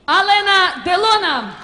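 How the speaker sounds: ringmaster. Acted. Host builds to announcing star.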